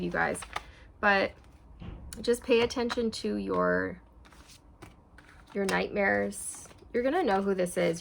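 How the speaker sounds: usual speaking voice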